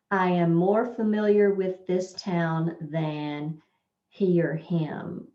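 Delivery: slow and loud, he or him drawn out; slow test reading. Shown as a demonstration. Teacher reads a quiz sentence slowly to students. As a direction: read the quiz item slowly aloud. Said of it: "here we're gonna test".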